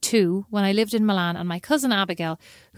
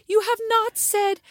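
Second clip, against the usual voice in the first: complaining friend; 10 semitones higher than usual. Retold or imitated. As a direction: drag it out high like a teasing friend. Julie complains, retold.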